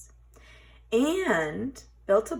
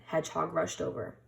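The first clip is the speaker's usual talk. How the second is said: usual speaking voice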